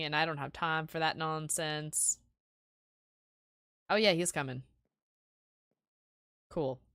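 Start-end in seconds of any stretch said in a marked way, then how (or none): none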